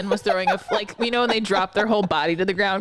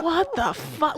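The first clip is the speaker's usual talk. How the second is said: laughing disbelief; 8 semitones higher, slower than usual. Retold or imitated. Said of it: "I was like".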